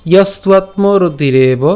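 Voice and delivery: ইয়স্ত্বাত্মরতিরেব recited slowly as one phrase; joined verse recitation. Shown as a demonstration. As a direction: run ইয়স্ত্বাত্মরতিরেব together in measured recitation. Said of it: "প্রথম থেকে আবার বলছি আমি".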